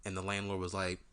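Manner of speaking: ordinary talk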